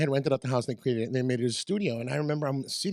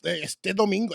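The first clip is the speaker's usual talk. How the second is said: higher, hyped Spanish promo opener; radio commercial voice; 4 semitones higher than usual. Shown as a demonstration. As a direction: launch a Tejano radio ad. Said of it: "the commercial?".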